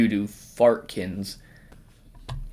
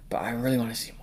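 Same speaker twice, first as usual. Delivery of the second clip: whispering; 7 dB quieter, much faster than usual. Real, not acted. Whispered aside, unvoiced.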